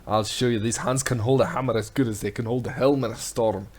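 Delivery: loud, hammy Scottish sea-dog; booming pirate smith. Acted. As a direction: bellow it like a proud old sailor. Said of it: "I'm having a little bit more fun than I should".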